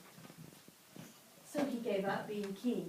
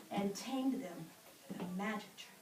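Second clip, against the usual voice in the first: ordinary delivery, nothing marked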